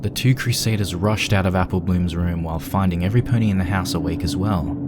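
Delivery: usual speaking voice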